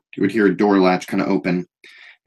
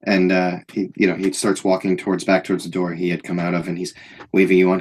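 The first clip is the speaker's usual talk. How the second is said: usual speaking voice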